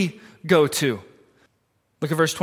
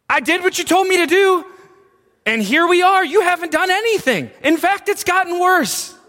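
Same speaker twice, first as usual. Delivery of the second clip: indignant complaint; 9 semitones higher, faster than usual. Retold or imitated. Moses complaining to God that things got worse, high indignant rant. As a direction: pour out the grievance to God. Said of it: "he's accusing God".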